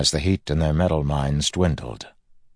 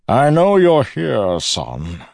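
loud, high, slow taunting call; 8 semitones higher, 7 dB louder, much slower than usual. A narrator's reading. Villain calls to the hidden man, loud and slow. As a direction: project across the room, easing down on "son".

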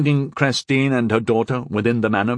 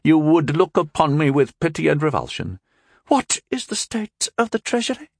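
angry reproof; 8 semitones higher than usual. A narrator's reading. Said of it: "Christine reproved angrily".

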